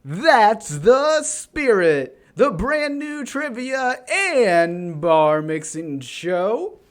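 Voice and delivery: slow, drawn-out host announcement; game show announcer. Acted. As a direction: announce it like a showman.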